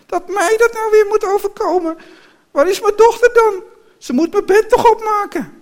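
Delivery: very high, loud lamenting woman; wailing. A narrator's reading. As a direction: wail in hammed-up self-pity. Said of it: "begon te jammeren". (hoog en luid jammeren)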